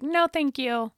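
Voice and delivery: high prim no thanks; snooty refusal. Retold or imitated. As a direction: decline primly and high. Retold own reply.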